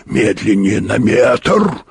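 slow, strained mild shout; shouted order. A narrator's reading. Explorer calls to the pilot to slow down, straining. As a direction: stretch the order out urgently. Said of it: "крикнул он".

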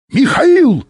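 very high, loud bark; barked name. A narrator's reading. Bykov stops the descending pilot, barking his name. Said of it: "рявкнул Быков и замолчал".

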